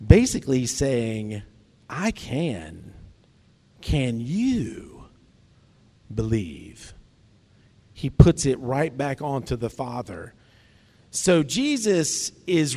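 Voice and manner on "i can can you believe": low, soft, spaced-out challenge; knowing challenge. Acted. Jesus to the father, quiet knowing challenge. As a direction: turn it gently back on him. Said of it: "with a twinkle in his eye".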